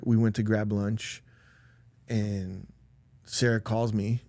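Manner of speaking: ordinary delivery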